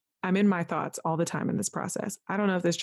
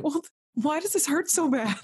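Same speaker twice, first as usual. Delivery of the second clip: much higher retold plea, half amused; mock-exasperated complaint; 9 semitones higher than usual. Patient in pain questions dismissive staff, mildly hammed-up exasperation. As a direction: lift the pitch in hammed-up frustration.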